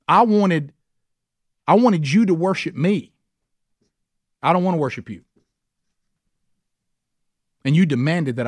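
Satan's resentment. Retold or imitated. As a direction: voice Satan's wounded fury, then let it sink. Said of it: "what Satan is basically saying is".

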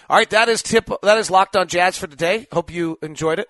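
upbeat host sign-off; podcast outro. Acted. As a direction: announce the show's close brightly.

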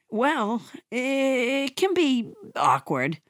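slow, halting admission; hesitant and awkward. Acted. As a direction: draw it out, uneasy. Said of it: "See, Adam?".

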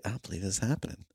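fast, soft words into a phone; quick soft disbelief spoken into a phone; murmured into phone. Retold or imitated. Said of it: "talking into my phone".

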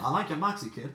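hammed-up old-movie New York accent; am-dram New York accent. Podcast host teasing his co-host, hammed-up New York accent. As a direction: put on a stagey New York gangster-film accent. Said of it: "that was some Amdram accent".

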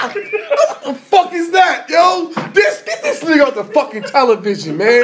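laughing disbelief. Real, not acted. Cracking up at rapper's mock answer.

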